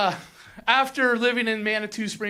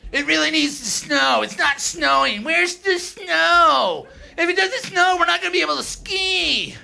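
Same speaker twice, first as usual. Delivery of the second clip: whiny local; 5 semitones higher than usual. Retold or imitated. Comic mocking locals for crying about snow, whiny high-pitched wail. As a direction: whine like a spoiled ski bum. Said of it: "They're like whiny little bitches".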